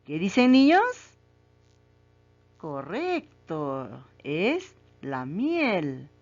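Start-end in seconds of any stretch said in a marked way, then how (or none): 2.6-6.1 very slow, savoured confirmation; slow drawn-out praise. Real, not acted. Teacher praising the children's right answer, drawn out slowly. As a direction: stretch each word out slowly, like rewarding the class.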